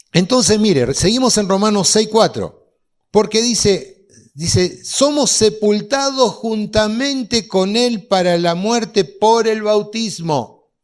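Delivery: loud, slow preaching of scripture; preaching at full voice; full-force preaching. Acted. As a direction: proclaim it to the whole church. Preacher reads scripture loudly.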